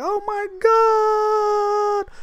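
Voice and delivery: very high, very slow awed "oh my God"; teen staring at the wallet, high and slowed in awe; amazed. Retold or imitated. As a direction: stretch it out in wonder. Amazed at money found.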